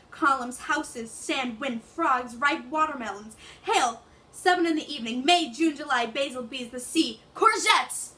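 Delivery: rising to a passionate cry; dramatic monologue. Acted. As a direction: build from grief to a desperate shout. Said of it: "I can act out the English version".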